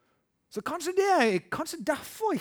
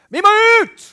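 high, loud shout of eagerness; bellowing evangelist cry; 18 dB louder, slower than usual. Retold or imitated. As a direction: yell it like an evangelist who can't wait. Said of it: "han som er evangelist som roper sånn".

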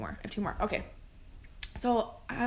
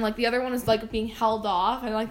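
ordinary talk, nothing marked

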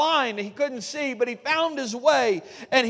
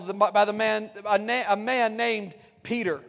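ordinary talk

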